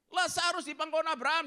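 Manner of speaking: loud, high-pitched sermon delivery; fiery preaching. Acted. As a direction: preach it hard to the crowd. Preaching at full force.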